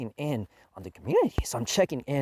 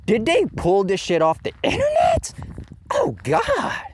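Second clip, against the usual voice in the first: retold outrage; 3 dB louder than usual. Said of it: "I was like".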